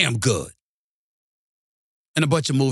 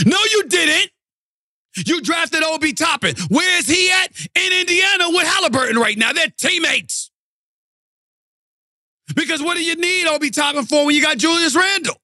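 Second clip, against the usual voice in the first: much higher, shouted fan fury; furious rant; 12 semitones higher than usual. Real, not acted. Lifelong fan yelling at the Knicks over the draft, furious. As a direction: yell it at the front office.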